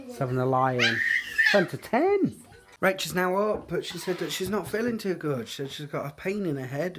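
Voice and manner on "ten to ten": mock surprise. Real, not acted. Mock shock at late hour.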